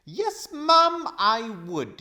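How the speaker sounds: high, loud peevish outburst; indignant old man. A narrator's reading. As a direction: let the temper burst out. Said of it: "temper at last got the better".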